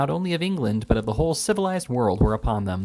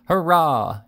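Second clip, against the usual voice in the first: loud, higher crowd cheer; cheering roar; 5 semitones higher, 6 dB louder than usual. A narrator's reading. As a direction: let out a big hurrah. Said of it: "another roaring".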